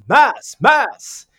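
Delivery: high, loud yelled imitation of a Greek cardiologist; imitated high-pitched Greek-accented call; Greek doctor's yell. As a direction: yell it out urgently in a mock Greek voice. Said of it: "say in this nice Greek voice".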